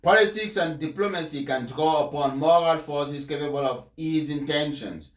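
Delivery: measured, solemn address read from the text; formal speech reading. Acted. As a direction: deliver it like a papal address. Reads the Pope's speech aloud.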